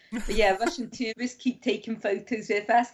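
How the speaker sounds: ordinary delivery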